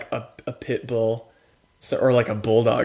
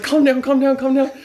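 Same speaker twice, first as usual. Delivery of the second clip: high, louder mock mom soothing; mom soothing dog; 13 semitones higher, 5 dB louder than usual. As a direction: settle the dog down, pitched up. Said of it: "She's like".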